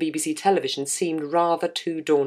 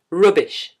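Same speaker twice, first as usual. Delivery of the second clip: imitated broad Geordie shout; shouted Geordie accent; 5 dB louder than usual. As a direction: call it out in a put-on Geordie accent, louder. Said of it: "shouted in his broad Geordie accent".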